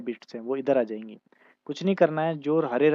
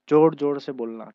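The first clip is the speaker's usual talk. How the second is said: louder; 8 dB louder than usual. Shown as a demonstration. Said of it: "speak loudly".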